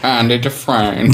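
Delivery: badly faked slow, low narrator; Morgan Freeman. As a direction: drag the name out in a botched celebrity voice. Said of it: "now you do your Morgan Freeman".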